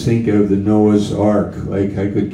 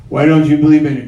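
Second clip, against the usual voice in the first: questioner's voice; 5 semitones higher, 6 dB louder, much faster than usual. Acted. Voicing the questioner.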